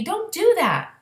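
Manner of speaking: high loud protest; pained yell. Acted. BFG protesting Sophie's noise in his ear, high loud yell. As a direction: cry out in pain.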